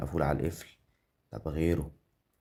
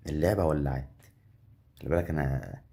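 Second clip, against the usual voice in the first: ordinary talk, nothing marked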